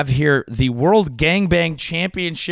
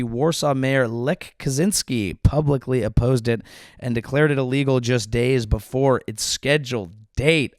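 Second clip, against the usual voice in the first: ordinary talk, nothing marked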